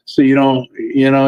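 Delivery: ordinary delivery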